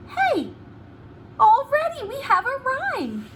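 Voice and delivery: high, bright-eyed delight at finding a rhyme; excited discovery. Real, not acted. Teacher to class, delighted to find a rhyme. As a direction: pop up high, thrilled by the rhyme.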